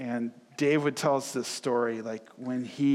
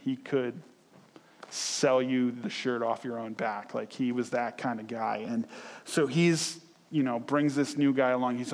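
ordinary talk

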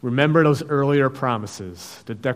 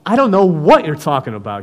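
loud denial; 4 semitones higher, 9 dB louder than usual. Acted. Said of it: "he denied it before them all".

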